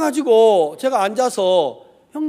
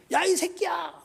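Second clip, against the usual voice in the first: high-pitched imitated curse; cursing; 9 semitones higher, 6 dB quieter than usual. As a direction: mimic an angry in-law swearing. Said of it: "저한테 막 욕을 하더라고".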